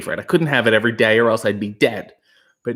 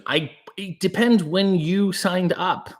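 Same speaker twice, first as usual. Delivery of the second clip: usual speaking voice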